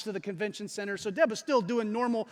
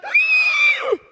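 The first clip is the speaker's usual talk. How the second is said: huge high-pitched loud gasp; exaggerated gasp; 16 dB louder than usual. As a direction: gasp as if disaster is coming. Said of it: "she gasps".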